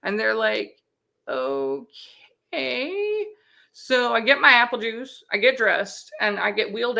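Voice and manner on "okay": long, drawn-out, doubtful okay; hesitant okay. Retold or imitated. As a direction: stretch it out slowly, humoring a loopy patient. Staff baffled by juice request.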